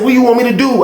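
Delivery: high-pitched, helpless protest; exasperated plea. Retold or imitated. Pleading with OG over the bad batch, exasperated. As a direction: throw the question up high in frustration.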